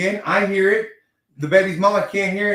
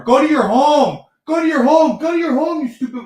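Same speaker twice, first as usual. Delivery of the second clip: Happy Gilmore yell; 10 semitones higher, 7 dB louder than usual. Retold or imitated. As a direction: shoo the mouse away like a furious golfer. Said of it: "yelling at the mouse like she's Adam Sandler".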